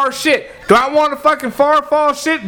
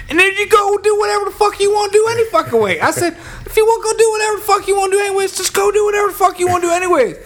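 high-pitched, retold telling-off; exasperated rant; 10 semitones higher, faster than usual. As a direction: send the coworker away in a high-pitched tirade. Acted quarrel with the coworker.

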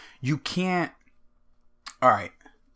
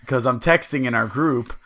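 normal talking voice

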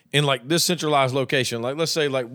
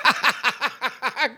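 high burst of laughter; 7 semitones higher than usual. Real, not acted. Laughs at drag idea.

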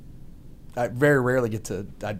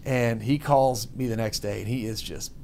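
ordinary delivery

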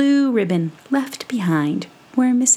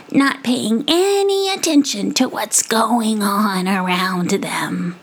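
scolding possum; 4 semitones higher than usual. Acted. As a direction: tut at the youth of today. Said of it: "for she was saying".

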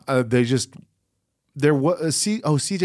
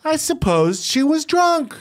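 high, slow, showy declaration; mock triumphant. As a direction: proclaim it high and drawn out. Triumphantly explains own supposition.

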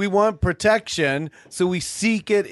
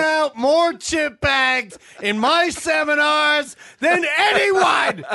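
shouting guru; 8 semitones higher than usual. Acted. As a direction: brag to the crowd.

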